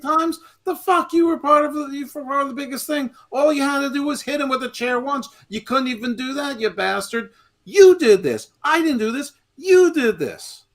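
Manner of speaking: loud, very high hammed-up accusation; Jay. Fan playing betrayed wrestler blaming his brother, hammed-up outrage. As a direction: wail it like an outraged wrestler's promo. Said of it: "And, and Jay's like".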